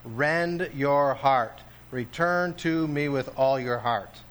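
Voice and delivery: slow, weighty command on "Rend". Acted. Preacher voices God commanding the people, weighty. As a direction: lean hard into each word. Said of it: "these are commands".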